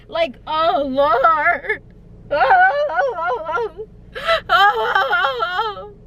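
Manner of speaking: high wailing oh sobs; mock sobbing. Mock sobs over her bad luck. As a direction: sob out theatrical oh's.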